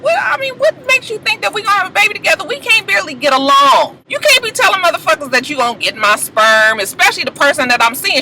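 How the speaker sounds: high mocking husband voice, fast; voicing the husband. Recapper voicing the husband pushing back at his wife. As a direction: act out the irritated husband.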